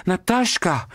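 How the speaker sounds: high piercing call across the night; shrill cry. A narrator's reading. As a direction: shriek her friend's name, high and thrilled. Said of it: "cria Marguerite d'une voix stridente".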